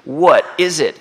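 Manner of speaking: what is it, bursting with delight; amazed exclamation. Acted. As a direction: cry it out in wonder. Marvelling at how good manna is.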